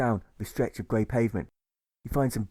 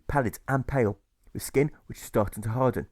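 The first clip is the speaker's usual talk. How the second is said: ordinary delivery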